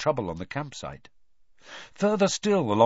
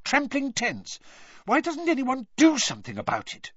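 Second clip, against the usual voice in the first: high, indignant protest; 11 semitones higher than usual. A narrator's reading. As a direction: bristle at the injustice. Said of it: "said Hermione indignantly".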